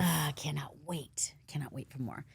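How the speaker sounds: hushed, breathy longing; breathy anticipation. Real, not acted. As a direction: whisper it like you're savoring the thought.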